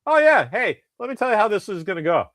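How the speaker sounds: high-pitched mock-sassy put-down; sassy comeback. Co-host retells her ripping the new guy, mock sassy. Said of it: "he's kinda being all sassy, and she's like".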